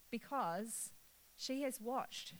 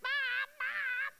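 put-on high, loud child's call down the street; child calling mum; 7 dB louder than usual. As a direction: yell for your mother across a crowd. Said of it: "people yell mum in the world".

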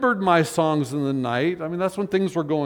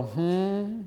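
pondering hmm; 2 semitones higher, 7 dB quieter than usual. Retold or imitated. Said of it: "Things That Make You Go".

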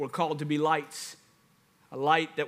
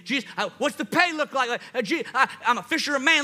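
hyped doubtful follower; 3 semitones higher than usual. Retold or imitated. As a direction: play the excited follower who keeps asking Jesus about hours and pay. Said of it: "this is probably what we would do".

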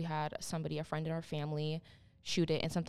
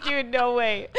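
loud, half-laughing disbelief; 6 semitones higher, 10 dB louder, slower than usual. Retold or imitated. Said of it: "I was like".

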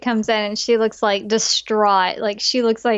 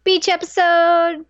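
excited announcement; 8 semitones higher, 4 dB louder, slower than usual. Real, not acted. Announcing next episode excitedly.

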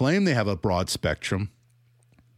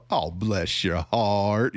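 slow, sugary Southern "Oh, bless your heart"; Southern grandma; much slower than usual. Retold or imitated. Critic voices a Southern grandma's sweet condescension. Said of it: "these grandmas in the South".

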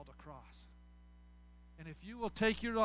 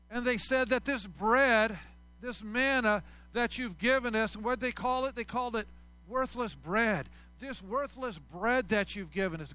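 louder, emphatic storytelling sermon; preaching at full force; 3 semitones higher, 8 dB louder than usual. Acted. Pastor retelling the Israelites' complaints, in a loud emphatic voice. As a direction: hammer home the grumbling in the wilderness.

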